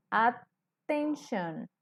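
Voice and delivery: "attention" muy lento, at... ten-shon; separado en sílabas. Shown as a demonstration. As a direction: pausa tras la primera sílaba de "attention". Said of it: "al pronunciarlo decimos". ("attention" silabeado despacio, at-ten-shon)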